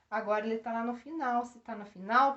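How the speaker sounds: ordinary delivery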